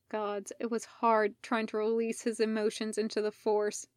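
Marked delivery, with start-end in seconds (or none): none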